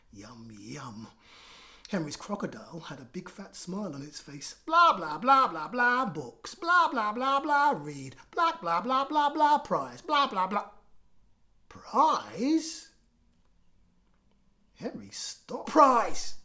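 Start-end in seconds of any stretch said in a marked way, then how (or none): 0.1-1.1 softer, whispered yum yum. Acted. Crocodile eating in picture. 4.7-10.7 higher, louder blah blah teacher drone; droning teacher. A narrator's reading. 11.7-12.9 higher, louder rising prize; surprised question. Acted. Henry suddenly interested. 15.7-16.4 much louder call of prize. Acted. Henry calling out in class.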